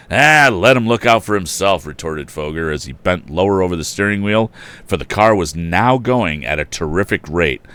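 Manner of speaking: loud, animated storybook reading; story narration. A narrator's reading. Narrator reading to listeners, animated and loud. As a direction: tell the tale with gusto. Reads story with character voices.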